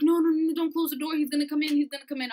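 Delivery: high, urgent pleading; acting out frantic alarm; imitated panic. Panicked roommate begs on acid.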